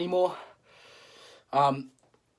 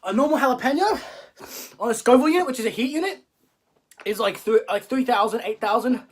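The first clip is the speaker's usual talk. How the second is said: strained, in pain; 5 semitones higher, 3 dB louder than usual. Real, not acted. Talking through burning chip.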